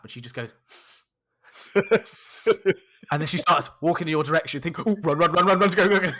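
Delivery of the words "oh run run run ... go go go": louder, urgent mock alarm; mock panic. Telling a friend to flee his admirer, mock panic. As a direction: urge a quick getaway in comic panic.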